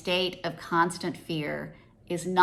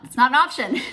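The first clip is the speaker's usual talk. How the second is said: laughing it out, a bit louder; words breaking into a real laugh; 6 semitones higher, 7 dB louder, faster than usual. Real, not acted. Laughs at her own point.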